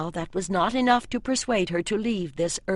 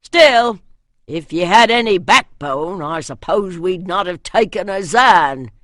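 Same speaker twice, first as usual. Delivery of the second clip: northern muttering; 9 dB louder than usual. A narrator's reading. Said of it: "His muttering faded".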